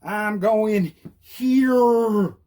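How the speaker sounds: hugely stretched words, HERE held long; very slow, hammed-up announcement; leaning into it. Shown as a demonstration. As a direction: drag HERE right out, exaggerated. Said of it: "Lean into it so they know".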